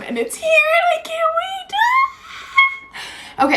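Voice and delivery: giddy high-pitched delight; excited squeal. Real, not acted. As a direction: squeal it out, can't contain yourself. Phone finally arrived.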